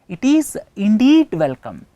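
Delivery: INDEED pushed loud and high; emphatic stress. Shown as a demonstration. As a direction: hit "indeed" hard to emphasize it. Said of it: "Because to emphasize the".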